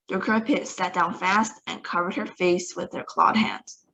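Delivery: ordinary delivery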